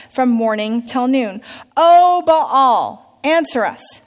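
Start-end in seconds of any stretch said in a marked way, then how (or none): 1.7-4.0 loud, higher cry to a god; shouting to Baal. Acted. Priests crying out to Baal, loud. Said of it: "they shouted".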